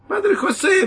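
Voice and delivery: put-on very high, loud children's shriek; squealing kids. Children shrieking the priest's name, very high and loud. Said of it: "визжали ребятишки".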